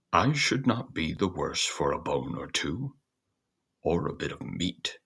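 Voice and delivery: a hint of a wistful old dog; lightly voiced dog hoping for a bone; hungry dog.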